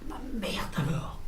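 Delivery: breathy whispered aside, slowed; hushed amazed whisper; stunned whisper. Retold or imitated. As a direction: whisper it under your breath in disbelief. Stunned aside hearing the news.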